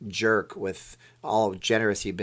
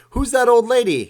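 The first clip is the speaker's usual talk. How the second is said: much higher and louder, put-on question; movie scene voice; 13 semitones higher, 11 dB louder than usual. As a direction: shriek it in a cartoonish voice. Acting out Wayne's World 2 scene.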